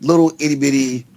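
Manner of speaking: normal talking voice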